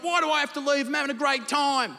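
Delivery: high-pitched whining objection; kicking-and-screaming protest. Acted. God dragged away by heaven police, high protesting whine. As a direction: complain like someone being dragged from a party. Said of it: "He's a kicking and screaming".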